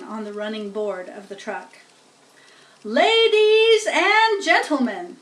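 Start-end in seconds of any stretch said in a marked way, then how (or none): 2.8-5.2 high, loud, slow grand opening; pompous loudspeaker. Acted. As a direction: open like a showman at the fair. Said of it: "said the loudspeaker".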